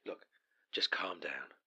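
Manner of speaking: low whispered calm down; hushed calming. Acted. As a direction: soothe him quietly. Calming angry partner.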